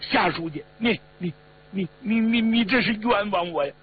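high, stammering self-defence; frightened pleading. A narrator's reading. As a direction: stumble over a panicked denial. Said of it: "吓得面色灰黄啊， 赶紧辩解".

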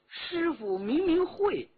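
high-pitched petulant complaint; aggrieved, high, sulky protest; grumbling pupil. Acted. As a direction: whine about the master, stretching it out. Pupil inwardly complains about his master.

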